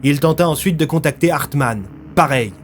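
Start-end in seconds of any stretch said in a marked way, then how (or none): none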